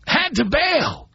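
slow emphatic echo of the line; slow, drawn-out repeat. Real, not acted. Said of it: "So I had to bail".

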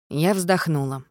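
ordinary delivery, nothing marked

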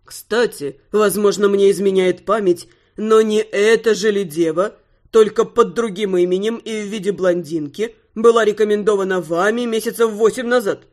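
low, put-on grieving aristocrat; mock-mournful lord voice. Male client character voiced by narrator.

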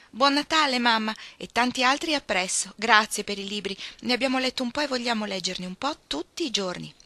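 auguri entusiasti, alti e forti; esclamazione festosa. Acted. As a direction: esclama a gara come bambine felici. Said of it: "esclamarono a gara". (esclamazione festosa, a voce alta)

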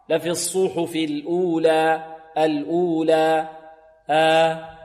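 final vowel of "الأولى" held evenly for two beats; slow chant stopping on a measured "الأولى"; two-count pause. Shown as a demonstration. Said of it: "نقف عليها بمقدار حركتين". (الوقف على "الأولى" بحركتين)

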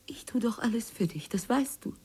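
intimate pleading whisper; soft whispered plea to her husband. Acted. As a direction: whisper it close, begging.

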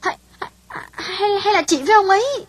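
alarmed. Acted. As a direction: stumble out a shocked guess. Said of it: "Hảo trợn mắt hốt hoảng". (hốt hoảng, cao và to hơn)

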